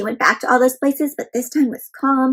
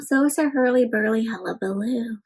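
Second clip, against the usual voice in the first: plain reading voice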